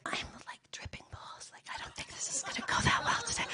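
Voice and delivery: hushed unvoiced whisper to a colleague. Whispering to her colleague on the bus. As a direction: breathe it out so no one hears. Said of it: "I, like, whisper to my colleague".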